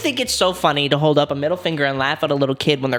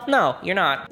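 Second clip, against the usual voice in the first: ordinary talk, nothing marked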